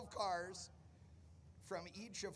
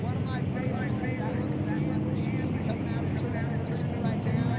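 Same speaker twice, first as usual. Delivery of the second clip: booming over the loudspeaker; PA announcer; 12 dB louder than usual. Real, not acted. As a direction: call it out over the PA. Announcing cars over loud PA.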